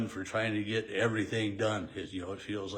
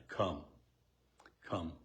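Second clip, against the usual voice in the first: a low, softer single word; soft command; 6 semitones lower, 7 dB quieter than usual. Acted. Jesus calling Peter onto the water, soft and low. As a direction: invite him gently, almost under breath.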